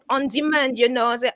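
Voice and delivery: ordinary delivery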